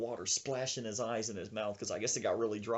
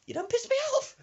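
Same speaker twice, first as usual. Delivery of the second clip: loud, very high squawk; mock-irate granny yell; fuming old woman; 6 dB louder, faster than usual. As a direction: burst out in angry falsetto. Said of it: "she pokes her head back in".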